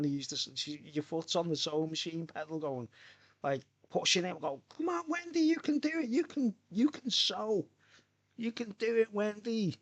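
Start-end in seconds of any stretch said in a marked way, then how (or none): 4.8-9.8 high, coaxing cheer-on voice; mock cheering. Friend cheering Wendy on at the sewing machine, high squeaky encouragement.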